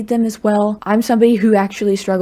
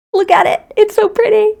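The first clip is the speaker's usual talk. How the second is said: much higher and louder, thrilled over the comic; gushing excitement; 12 semitones higher, 4 dB louder than usual. Real, not acted. Creator showing a comic to viewers, excited squeal. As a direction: squeal with delight at the pages.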